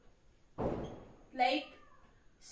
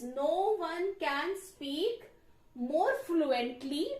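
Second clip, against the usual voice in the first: slow, deliberate dictation pace; slower than usual. Shown as a demonstration. Teacher writing the sentence on the board, dictating slowly. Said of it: "So we'll write it like this".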